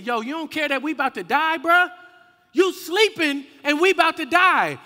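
alarmed protest. Acted. Disciples to sleeping Jesus as they're about to die, alarmed protest.

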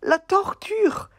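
very high, loud mock wailing; hammed-up cries of pain. Puppet squeals for help while the elephant brushes it. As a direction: ham up a tortured puppet.

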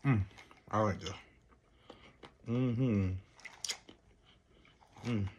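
mumbling while chewing. Real, not acted. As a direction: mouth partly full. Seen 5: head down, jaw working after eating nuts; talks clearly after.

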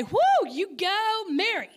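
high, slow, whooping cheer of approval; mock cheer. Mocking the guests with a cheer nobody gave her. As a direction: cheer her on like a fan, drawn out. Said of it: "Did they say".

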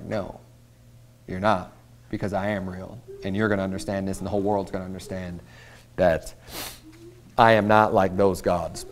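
God quietly firm with boasting Assyrians; voicing God. God tells proud Assyria, low and hushed. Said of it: "And God's like".

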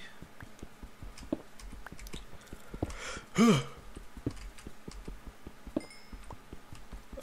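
drawn-out airy yawn; long yawn. Real, not acted. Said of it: "with all this yawning".

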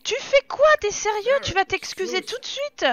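a high, loud incredulous demand; indignant shout. Real, not acted. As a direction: demand he apologise at once. Repeats, demands an apology.

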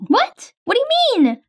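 high alarmed what, pretend panic; alarmed surprise. Patient hearing the wrong vaccine was given, high alarmed. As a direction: jump up in pitch, caught off guard.

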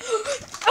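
sharp high gasp of surprise; excited gasp. Acted. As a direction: gasp like you can't believe it. Fan amazed.

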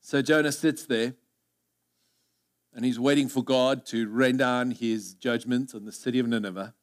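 usual speaking voice

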